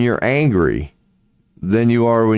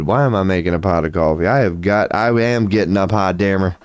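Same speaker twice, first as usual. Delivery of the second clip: ordinary talk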